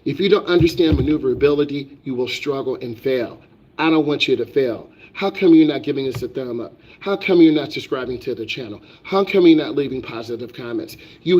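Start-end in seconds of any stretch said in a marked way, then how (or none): none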